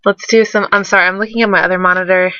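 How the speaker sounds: ordinary delivery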